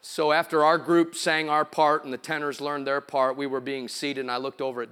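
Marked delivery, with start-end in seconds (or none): none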